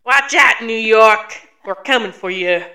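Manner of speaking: loud, hammed-up Midwesterner's New York accent; bad New York accent. Midwestern host yells a challenge to New York, mock accent. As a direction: shout it like a badly faked New Yorker. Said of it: "a Midwesterner trying to do it in a New York accent".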